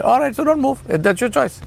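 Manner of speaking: high-pitched, offhand concession; breezy, high-pitched "suit yourself" tone; mock giving up. Dismisses the imagined stubborn listener.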